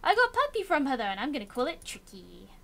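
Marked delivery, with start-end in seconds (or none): 1.0-2.5 whispered aside. Real, not acted. Naming puppy in a hushed aside.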